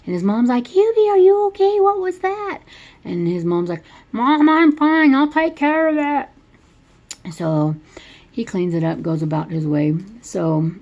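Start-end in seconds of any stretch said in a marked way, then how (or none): 0.6-2.6 much higher, louder call of the worried mom. Retold or imitated. Retelling the movie, mom calls to Hubie after the crash, much higher and louder. 4.1-7.3 much higher, louder and slow call back; drawn-out reassuring shout to mom; Hubie calling back. Retold or imitated. Retelling the movie, Hubie calls back to his mom, slow, much higher and louder.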